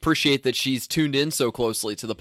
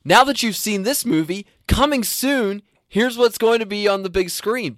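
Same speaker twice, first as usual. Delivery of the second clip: high-pitched pretend coming-attractions pitch; mock trailer announcer; 8 semitones higher than usual. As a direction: announce the next film, pitched up. Voicing an end-of-film trailer.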